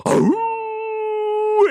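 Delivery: long, high-pitched ooh; sustained high whoop; excited hoot. Real, not acted. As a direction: draw it out high like a crowd hoot. Greeting viewers in chat.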